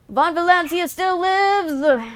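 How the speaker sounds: loud, high, slow victory cry; drawn-out rallying shout; triumphant proclamation. Pretend. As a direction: proclaim it like a conquering lord.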